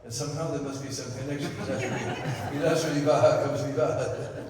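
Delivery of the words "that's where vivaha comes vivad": chuckling out the punchline, louder and slower; laughing through a joke. Real, not acted. Teacher laughing at his own marriage joke to the audience. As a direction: laugh as you land the pun.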